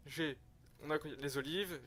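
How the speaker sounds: ordinary talk, nothing marked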